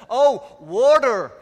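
high, loud, drawn-out American "Oh, water"; mock American realisation, stretching "water" slowly; exaggerated American accent. American finally understands the preacher, exaggerated American accent. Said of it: "That's how they pronounce it in the States".

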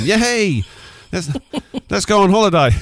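excited. Pretend. Acted happy partner quote.

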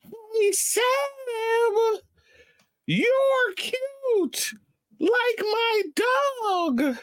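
very high squeaky voice; zing voice. Pretend. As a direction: squeak the whole roast way up high. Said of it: "zing voice".

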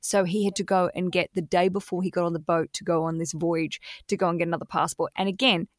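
normal talking voice

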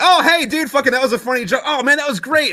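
very high, excited gushing; hammed-up high-pitched praise; chummy enthusiasm. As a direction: act out a delighted party buddy. Enemies bond over jokes.

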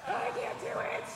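high soft whiny protest; strained inner whine. Retold or imitated. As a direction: whimper it high and soft, resisting. Struggling to raise her hands.

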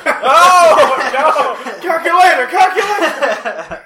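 loud, high and laughing, the second slow; gleeful laughing shout of the joke; laughing repeat. Real, not acted. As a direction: crow it through laughter.

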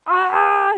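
sharp, high, very loud cry of alarm; sudden panicked shout; loud scream. Acted. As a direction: let out a startled yell. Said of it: "gritó Robustiano".

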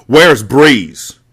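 loud, high demand; screamed question. A narrator's reading. Mecca demanding from Mati at gunpoint, screaming. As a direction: scream it with the gun at his face. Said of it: "Mecca screamed".

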